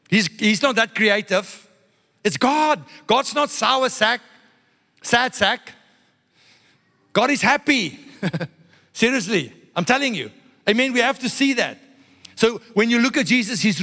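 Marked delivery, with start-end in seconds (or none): none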